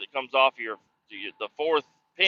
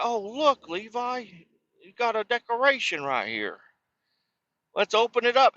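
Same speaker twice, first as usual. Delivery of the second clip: higher, playful voice, showing off a surprise; cheerful dad to son; 11 semitones higher than usual. Retold or imitated. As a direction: sell the find to a little boy. Dad shows son his decoration.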